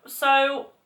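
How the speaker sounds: ordinary talk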